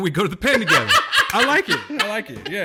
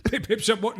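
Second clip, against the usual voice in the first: mock posh British; 5 semitones higher, 5 dB quieter than usual. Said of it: "everyone's got dope accents".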